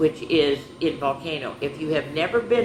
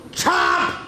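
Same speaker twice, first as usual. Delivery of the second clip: chomp; 12 semitones higher, 6 dB louder than usual. Acted. Goldfish bites finger.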